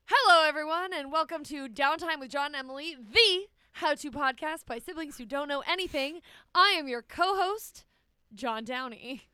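high, loud announcer voice; podcast intro. Pretend. Sister auditioning for the intro, bright announcer voice. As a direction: belt it like a radio host opening the show. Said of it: "You're looking to audition me for the intro".

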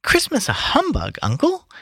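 high, surprised nephew voice; nephew's voice. Acted. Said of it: "said Scrooge's nephew".

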